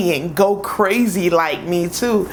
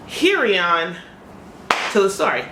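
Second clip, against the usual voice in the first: normal talking voice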